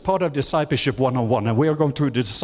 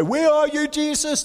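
high, pleading cry; panicked disciples; 12 semitones higher than usual. Retold or imitated. Disciples in the boat crying to sleeping Jesus, high and pleading. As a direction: cry out like frightened sailors. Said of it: "they say".

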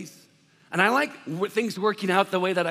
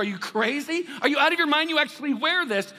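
incredulous; 8 semitones higher than usual. Acted. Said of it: "They said, they go".

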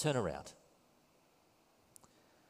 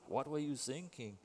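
put-on German accent; 2 semitones higher, 9 dB quieter than usual. Repeats the German-accented quote, softly.

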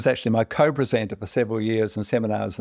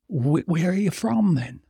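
tipsy Irishman; 7 semitones higher than usual. Acted. Drunk Irishman in bar joke.

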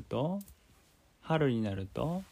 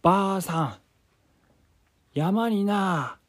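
put-on high, loud old man; 10 semitones higher, 6 dB louder than usual. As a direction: boast like an excited old grandpa. Grandpa voiced in story.

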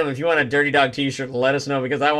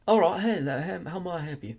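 put-on British accent; 4 semitones higher, 5 dB quieter than usual. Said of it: "a British museum".

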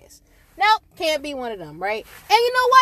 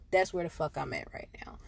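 breathy unvoiced whisper, much softer; whispered aside; 10 semitones lower, 13 dB quieter than usual. Real, not acted. Podcaster confiding her mood to listeners, whispered. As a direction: drop to a secret whisper. Aside after swearing rant.

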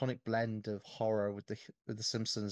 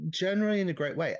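higher, louder, fast eager repeat; excited echo; 8 semitones higher, 8 dB louder, faster than usual. Real, not acted. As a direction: grab the phrase and throw it back gleefully. Excitedly echoing the other host.

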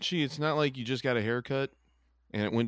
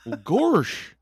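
a touch of the goofy cartoon voice, louder; 4 semitones higher, 6 dB louder than usual. Friend tries goofy voice for neutral mood. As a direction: drawl gorsh like Goofy. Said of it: "Can neutral be goofy?".

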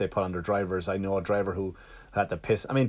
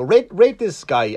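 mock Amazon demand; 8 semitones higher, 7 dB louder, slower than usual. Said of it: "I get an email from Amazon and go".

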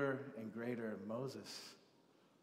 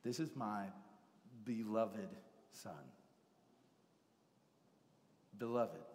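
tender; 3 semitones lower, 4 dB quieter than usual. Acted. Slow reverent repetition.

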